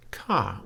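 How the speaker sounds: "ka" with the pitch falling; polite "ka" on a falling tone. Shown as a demonstration. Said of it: "with a falling tone".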